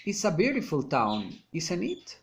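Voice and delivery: "isn't it" climbing at the end; rising tag question. Shown as a demonstration. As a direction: lift the pitch sharply on final "it". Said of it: "falling or rising intonation".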